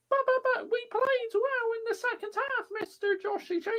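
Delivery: high, pleading mock fan voice; whiny fan voice. Imitating a fan defending the manager, high and whiny.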